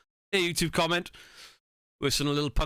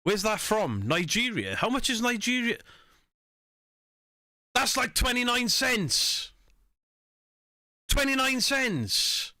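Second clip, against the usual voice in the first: mocking the streamer; 7 semitones higher than usual. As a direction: ham up his ungrateful thank-you. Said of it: "he went".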